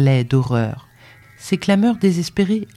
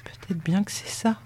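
very soft, shamefaced admission; ashamed stammer; 3 semitones higher, 9 dB quieter than usual. A narrator's reading. Servant girl admitting a lover to her master, ashamed. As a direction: confess it under your breath, ashamed. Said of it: "Elle balbutia, tremblant de honte".

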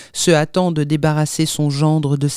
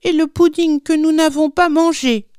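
put-on very high little-boy protest; complaining child; 10 semitones higher than usual. A narrator's reading. Little Odet complaining about the uneaten pudding, aggrieved. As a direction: pipe up with a childish grievance.

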